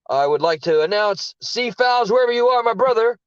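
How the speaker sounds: loud announcement. Real, not acted. Grand shout-out announcement.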